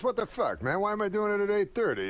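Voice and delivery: high, quick exasperated complaint; griping Rodney. Retold or imitated. Old comic gripes about doing dress rehearsal, exasperated. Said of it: "He goes".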